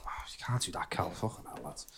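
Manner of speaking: whispered, very soft disbelief; whispered aside. Retold or imitated. Noticing Cal cut through the giveaway, whispered aside. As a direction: hiss it under your breath. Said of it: "I was like".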